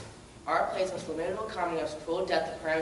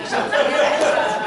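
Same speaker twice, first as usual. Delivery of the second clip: laughing; 9 semitones higher, 13 dB louder, much slower than usual. Real, not acted. Laughing loud.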